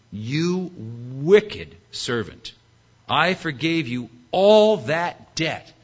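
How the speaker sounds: slow, weighty reprimand; stern rebuke. Acted. Master to the wicked servant, sternly rebuking.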